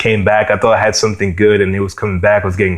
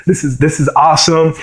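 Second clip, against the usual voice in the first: high, excited disbelief; young guitarist hearing about his first gig, thrilled; 8 semitones higher than usual. Retold or imitated. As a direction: gush like a kid getting his first gig. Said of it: "I was like".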